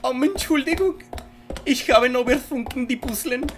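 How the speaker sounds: high mock German accent, half gibberish; fake German. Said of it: "German is such a fake language".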